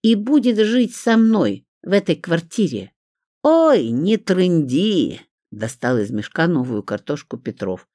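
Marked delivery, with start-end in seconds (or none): 0.0-2.9 high, insistent declaration; declaring. A narrator's reading. Woman claiming her daughter, insistent declaration. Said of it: "заявила демоническая женщина". 3.4-5.2 high, loud, drawn-out brush-off; dismissive. A narrator's reading. Petrov cutting off Olga's claims, dismissive.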